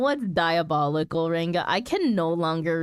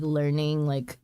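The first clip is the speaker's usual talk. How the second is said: ordinary talk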